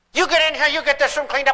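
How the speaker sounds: mock-angry parent ordering the kids; acting angry. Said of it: "you even act angry".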